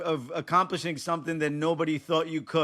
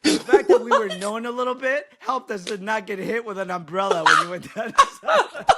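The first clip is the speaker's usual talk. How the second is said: louder, laughing through the words; amused by the umbrella story; 4 semitones higher, 6 dB louder than usual. Real, not acted. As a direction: chuckle through the whole memory. Laughing about umbrella attacks.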